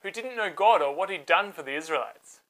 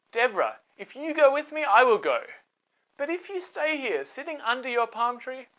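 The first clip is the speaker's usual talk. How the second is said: higher, insistent bargaining voice; Barak bargaining; 6 semitones higher than usual. Acted. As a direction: hold out for a condition.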